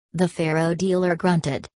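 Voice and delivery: ordinary delivery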